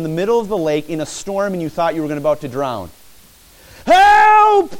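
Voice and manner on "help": high, loud desperate scream; scream for help. Shown as a demonstration. Preacher shows a drowning man's scream, high and loud. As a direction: scream like you're about to drown. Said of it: "You'd be screaming is what you'd be doing".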